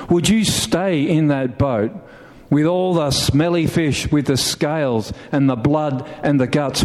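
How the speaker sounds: "smelly fish" said with disgust in the voice; negative inflection. Shown as a demonstration. Pastor running down the fish to the congregation, with distaste. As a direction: make "smelly fish" sound unpleasant. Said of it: "put the negative inflection on the fish".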